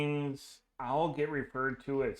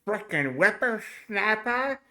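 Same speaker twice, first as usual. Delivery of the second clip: lightly voiced cranky old man; old prospector; 5 semitones higher, 10 dB louder than usual. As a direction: grumble it like an old-timer. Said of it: "do the old voice".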